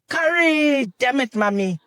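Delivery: high, lightly done copy of an outburst; half-hearted mock scream; mock shock. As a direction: mimic a friend's shocked cry, toned down. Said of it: "you're shocked and you're like".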